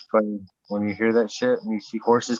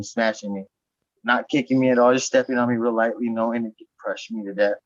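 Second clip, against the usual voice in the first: ordinary talk, nothing marked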